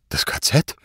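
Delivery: startled, small-voiced question; frightened question. A narrator's reading. Zott hears the threat of the camp, startled. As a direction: ask in mild shock. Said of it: "fragte er erschrocken".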